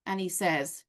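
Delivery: usual speaking voice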